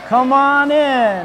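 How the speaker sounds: slow, drawn-out vampire count welcome; spooky Count voice. Pretend. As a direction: stretch it out like a creepy count. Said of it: "how about some from Count Richmond".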